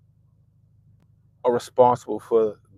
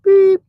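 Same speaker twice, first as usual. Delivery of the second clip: a high loud beep, like a bleep; mock TV bleep sound; censor bleep; 13 dB louder than usual. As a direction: squeal a sharp censor beep. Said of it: "the shit must be like".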